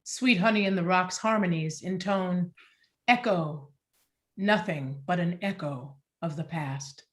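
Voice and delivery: usual speaking voice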